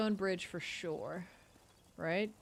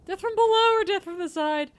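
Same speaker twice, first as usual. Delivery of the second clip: excited; 12 semitones higher, 12 dB louder than usual. Real, not acted. Joking about attack tactics.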